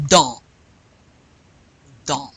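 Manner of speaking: "Dans" with nasal vowel, no N or S; teacher's isolated "Dans", nasal ending; nasal, silent endings. Shown as a demonstration. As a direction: leave N and S silent in "Dans", vowel through the nose. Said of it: "You don't pronounce the N or the S".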